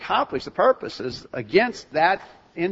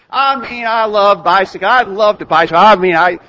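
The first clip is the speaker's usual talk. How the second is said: loud, tumbling over the words with love; carried away, loud and raised; gushing enthusiasm; 4 semitones higher, 9 dB louder than usual. Acted. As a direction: gush insistently, restarting as you go. Gushing about loving bicycles.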